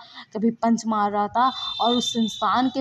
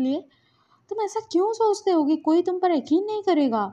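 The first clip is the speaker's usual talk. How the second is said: higher, insistent pleading rebuke; earnest reproach; 6 semitones higher, 4 dB louder than usual. Acted. Wing tells Lansan they would believe them, insistent. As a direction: press the point with hurt concern. Upset partner reproaches and reassures.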